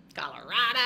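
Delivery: loud put-on accent; exaggerated accent on Colorado. Real, not acted. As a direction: belt out the place name in a playful accent. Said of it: "I don't know why I said that with an accent".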